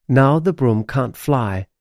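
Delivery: ordinary delivery, nothing marked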